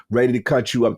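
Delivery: ordinary talk, nothing marked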